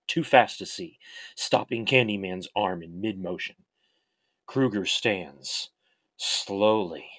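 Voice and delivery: dramatic horror storytelling, measured pace; story narration. A narrator's reading. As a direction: read it like a dark tale.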